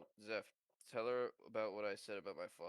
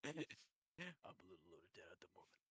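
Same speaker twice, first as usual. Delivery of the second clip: very quiet game-hero impression; understated, hushed soldier quote; Master Chief; 3 semitones lower, 10 dB quieter, faster than usual. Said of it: "really like the Master Chief was".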